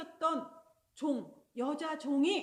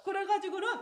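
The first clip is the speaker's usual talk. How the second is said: ordinary delivery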